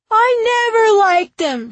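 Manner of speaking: put-on very high, loud child's yell; shrieked little-kid denial; shouting back. A narrator's reading. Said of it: "he shouted back".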